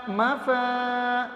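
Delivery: ما flowing into a long-held فا; gentle, without jerk. Shown as a demonstration. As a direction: ease into فا and stretch it slowly. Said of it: "پورا پہلے کھینچو آرام سے". (ما فا آرام سے، کھینچ کر)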